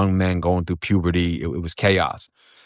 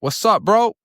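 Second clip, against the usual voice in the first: excited greeting; 13 semitones higher, slower than usual. Retold or imitated. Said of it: "I say".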